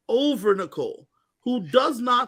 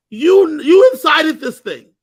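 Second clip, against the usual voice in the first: louder and higher, slow accusing; heated accusation; exasperated outburst; 8 semitones higher, 12 dB louder, slower than usual. Real, not acted. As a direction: jab each word of you incited this. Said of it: "accusing outburst".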